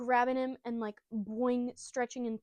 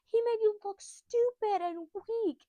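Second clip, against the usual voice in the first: high, loud, slow mock tantrum; indignant kid outburst; 12 semitones higher, 6 dB louder, slower than usual. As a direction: draw out each accusation in a high yell. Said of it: "And he's like".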